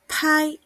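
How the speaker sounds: "pai" pitched high with a strong puff on the p; aspirated p. Shown as a demonstration. Teacher contrasting bai with aspirated pai. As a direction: burst of air on "pai". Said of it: "tengo el bai y el".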